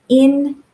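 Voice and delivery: En said alone; isolated syllable. Shown as a demonstration. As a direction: split En off the word. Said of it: "has that E".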